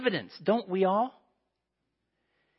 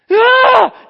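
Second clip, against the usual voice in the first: much higher, very loud cry of horror; a shriek at the diseased hand; shocked scream; 21 dB louder than usual. Acted. Moses sees leprosy on hand.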